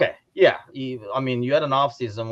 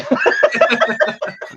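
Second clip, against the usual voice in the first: breathy laugh; 12 semitones higher, 7 dB louder than usual. Real, not acted. Laughing hard.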